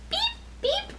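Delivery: high, short horn beeps; truck horn beeps. Acted. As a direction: beep like a little truck. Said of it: "Little Blue Truck says".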